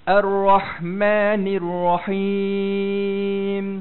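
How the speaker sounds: loud, very slow chanted recitation; Quranic recitation. Shown as a demonstration. Teacher recites the third verse for students, Quranic chant. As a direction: recite with long held vowels, ringing.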